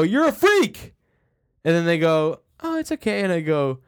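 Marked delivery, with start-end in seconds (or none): 0.0-0.9 high loud yell at himself; self-loathing shout. Retold or imitated. 2.6-3.2 put-on reassuring girlfriend.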